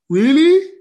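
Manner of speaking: high, loud rising question; mock disbelief. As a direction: feign amazement, pitch climbing. Acts astonished at the verse.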